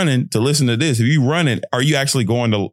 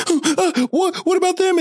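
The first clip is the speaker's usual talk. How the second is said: startled. Retold or imitated. Retold startled reaction to friend.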